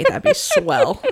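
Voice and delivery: slow, high, through a laugh. Real, not acted. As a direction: laugh it off, embarrassed. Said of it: "If everybody could just erase that".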